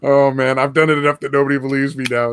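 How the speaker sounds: normal talking voice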